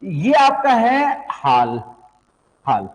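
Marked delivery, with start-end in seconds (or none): 1.3-1.8 haal with an open "aa", drawn out; long "aa" in haal. Shown as a demonstration. Teacher contrasting hall and haal for students, open "aa" in haal. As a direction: say haal as in haal-chaal. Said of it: "मतलब हालचाल".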